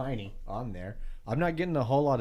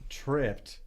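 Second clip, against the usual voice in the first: ordinary delivery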